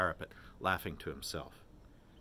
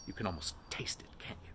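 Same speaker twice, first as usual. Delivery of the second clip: whispered, hushed and sly; teasing whisper; 7 dB quieter than usual. A narrator's reading. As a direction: lean in and whisper the tease. Mulligan's teasing aside.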